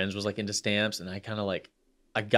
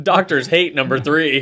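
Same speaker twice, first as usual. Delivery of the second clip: slow, hyped clickbait teaser; clickbait video narrator; 4 semitones higher, 7 dB louder than usual. Pretend. Clickbait headline parody.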